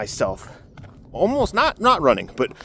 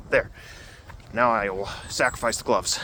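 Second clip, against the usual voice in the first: usual speaking voice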